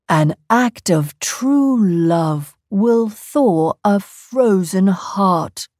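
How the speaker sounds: slow, deliberate reciting; slow wise recital. Acted. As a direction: recall a saying solemnly. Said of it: "said Olaf".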